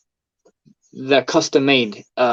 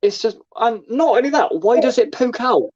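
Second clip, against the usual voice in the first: high-pitched exasperated rant; incredulous, high comic complaining; comic indignation; 10 semitones higher than usual. Acted. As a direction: rant in mock outrage, pitched well up. Comic rant about the funny bone.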